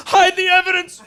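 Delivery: high, loud panicked yell; mock panic. Driver caught with the jar by cops, high panicked shout. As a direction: yelp it in comic panic.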